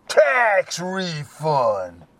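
slow, drawn-out disdainful Tax refund. Retold or imitated. Drug dealer answering Eddie, slow and disdainful. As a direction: dismiss the question with contempt. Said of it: "He goes all disdainly".

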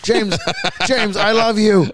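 high, loud pleading fan voice; begging James. Pretend. Begging [name]'s singer.

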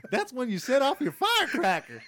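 loud, high mock Southern holler; Southern accent. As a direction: holler it like a hammed-up Southern weatherman. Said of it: "why is he Southern?".

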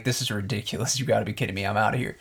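low, quiet imitated grumble; muttered complaint. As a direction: mutter it under your breath. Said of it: "he could be like".

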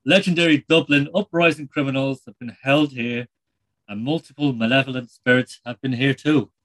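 put-on light Irish accent, chatty presenter; a lightly done Irish TV host; Terry Wogan. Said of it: "It's Terry Wogan here".

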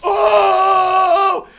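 very high, full-volume shrieks of pain; acted agonized screaming. Dad burned by Tiger Balm, acted agonized screams. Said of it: "I'm screaming and I'm running around".

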